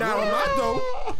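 high slow wailing protest; disgusted outcry. Real, not acted. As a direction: wail it in disgust.

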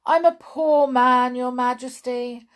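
high, plaintive plea; miserable. A narrator's reading. Hatter pleading poverty to His Majesty, high miserable plea. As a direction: wail it pitifully, high then sinking a little. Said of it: "said the Hatter miserably".

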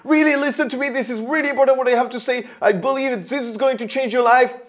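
loud, high, fast hard sell; pushy stage pitch. Shown as a demonstration. Coach imitating a stage speaker enrolling people, loud and pushy. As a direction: force the message on the audience. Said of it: "speaking of the stage to enroll people".

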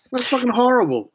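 high, strained disgusted outburst. Real, not acted. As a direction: recoil in disgust. Disgusted reaction repeated.

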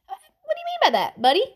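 quick, high-pitched put-on challenge; high, hurried comeback; mock challenge. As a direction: fire back fast in a high put-on voice. Acted example of the wrong reply.

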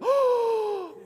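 shocked church lady, overdone for laughs; mock scandalized. Pastor imitating his congregation's gasp, scandalized church lady.

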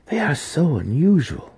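high, wondering voice; marveling. A narrator's reading. Daughter marveling to her mother about the baby, lightly high and wondering. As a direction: sound amazed by the eyes.

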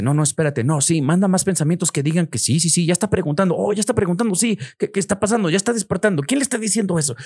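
high, alarmed voice of a mind losing control; mock panic of the reactive mind; panicked reactive mind. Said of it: "tu mente reactiva".

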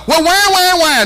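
teasing crybaby wail; mock crying. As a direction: whine it like a crybaby. Mocking the complainer.

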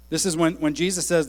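ordinary talk, nothing marked

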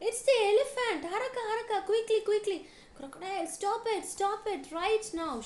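high, loud alarmed hippo; urgent shout. A narrator's reading. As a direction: shout frantic orders at high pitch. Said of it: "shouted Hippo".

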